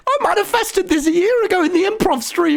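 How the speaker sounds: very high, hushed squeal of disbelief; awestruck squeal. Retold or imitated. Standing in the ice cream line, squealing in amazement. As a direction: squeak it out like a dream come true. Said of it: "I'm just stood in the line like".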